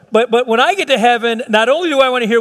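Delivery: normal talking voice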